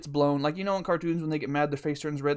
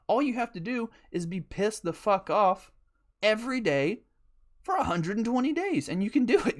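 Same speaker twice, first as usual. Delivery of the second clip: mock breezy advice; 6 semitones higher than usual. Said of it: "I'm like".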